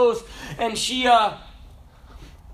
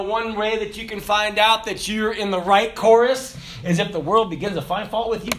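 normal talking voice

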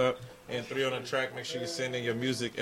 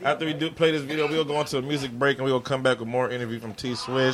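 loud, high hype host shout; radio announcer; 6 semitones higher, 13 dB louder than usual. Acted. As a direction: pump up the radio crowd. Radio host hyping the show.

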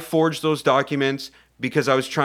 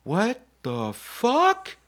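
slow, drawn-out high astonishment; acted disbelief; 8 semitones higher, slower than usual. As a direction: stretch each word in mock shock, pitched up. Crew discover baby in the case.

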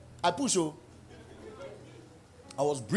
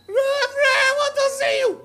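caller calling out; 5 dB louder than usual. Acted. Caller demanding the Reverend.

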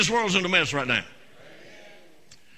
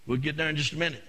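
normal talking voice

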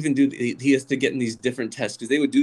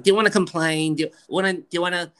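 higher-pitched New York mother impression; New York mom; 7 semitones higher, 4 dB louder, faster than usual. Mom asking about complaining, insistent New York accent. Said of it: "she's like".

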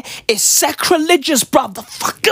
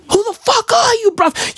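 much higher, pushing the question at his reflection; higher demand to the mirror; 10 semitones higher than usual. Retold or imitated. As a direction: jump way up in pitch and demand it. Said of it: "I look at myself in the mirror and I go".

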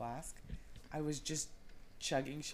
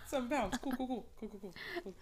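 mock dismay; 10 semitones higher, 5 dB louder, faster than usual. Mock reaction to the scale.

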